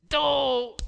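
sudden high, loud "Don't!"; emphatic shout. Real, not acted. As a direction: cut them off hard. Rejecting a false accusation.

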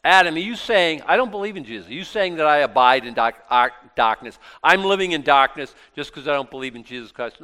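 hammed-up affronted questioning at full volume; indignant challenge. An objector challenging the preacher, mock indignant.

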